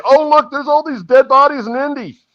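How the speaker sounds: high-pitched mocking quote; mock gullible public. Mod imitating people who believe fake news, high mocking voice. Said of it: "They're gonna say".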